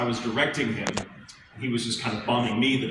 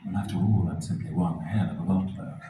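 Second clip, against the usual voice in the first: lower, suave butler voice delivering the catchphrase; Sebastian voice; 5 semitones lower, 4 dB quieter than usual. Pretend. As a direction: purr the catchphrase like the demon butler. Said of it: "Sebastian catchphrase on request".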